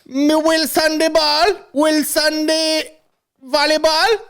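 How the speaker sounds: slow, high-pitched mock Jamaican patois; squeaky Jamaican accent, spelling out the clue; hammed-up drawn-out Jamaican voice. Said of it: "He's at parties like".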